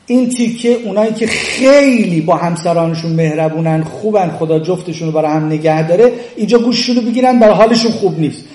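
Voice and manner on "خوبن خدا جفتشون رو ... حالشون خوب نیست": ordinary delivery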